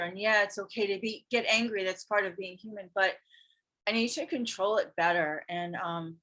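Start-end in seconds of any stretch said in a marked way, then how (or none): none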